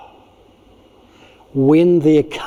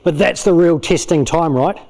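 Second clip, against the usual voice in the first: ordinary talk, nothing marked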